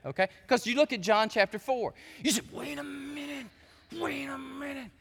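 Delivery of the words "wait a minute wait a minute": hushed, higher put-on voice; mock objection. Listener balking at John chapter four, mock hushed objection. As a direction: quietly voice a doubting listener, pitched up.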